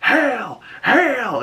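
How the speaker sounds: high, drawn-out chant of acclaim; mock yelling. Inmates saluting their leader, high mock yell. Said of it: "saluting him and yelling".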